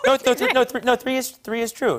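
high, laughing scramble to correct himself; flustered laughing. Real, not acted. [name] catching his own mistake, laughing high.

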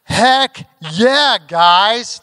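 put-on loud, high teenage whoop; rowdy cheer. Teenagers mocking the line, loud high whoop. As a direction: yell it out like rowdy teens. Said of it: "And they're like".

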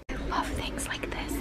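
very soft, delighted whisper; amazed whisper. Real, not acted. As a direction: whisper your love of the finds. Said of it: "me whispering at all this amazing stuff".

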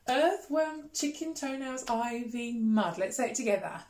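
usual speaking voice

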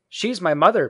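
put-on high, loud boyish announcement; proud boy. A narrator's reading. Peter explains Jane to Wendy, loud high boy voice. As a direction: declare it loudly as a boy.

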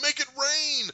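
high, loud frantic order; mock panicked shout. Thanos freaking out as retold, panicked shout. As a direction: imitate a villain shrieking an order.

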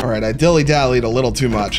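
ordinary talk

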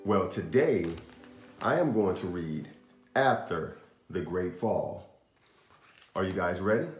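ordinary delivery

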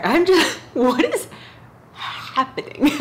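can't stop giggling, voice going high; real laughter breaking through the words; giggling helplessly while trying to talk; laughing fit. Real, not acted. Breaks down laughing mid-intro.

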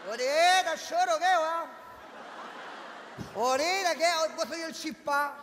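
a hint of a high, stoned street guy. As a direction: play the stoned slacker lightly. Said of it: "hiciera el papel de volado".

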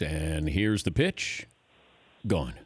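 low, slow, hushed broadcaster call; home run call. Shown as a demonstration. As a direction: hold back, then drop the word. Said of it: "give me the home run call".

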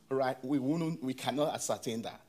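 ordinary delivery

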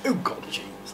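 whispery mimicry of a friend; Gabe impression. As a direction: imitate your mate in a near whisper. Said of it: "That's what he talks like".

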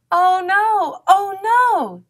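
high, loud repeated cry; doubled high-pitched wail of dismay; panicked yell. A narrator's reading. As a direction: yell out in storybook alarm. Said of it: "yelled Duck".

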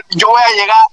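normal talking voice

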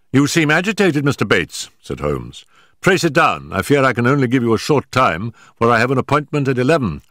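a lightly voiced Holmes reasoning the case through. Holmes explaining the evidence to Watson, measured detective voice.

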